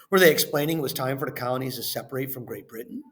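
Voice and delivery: ordinary talk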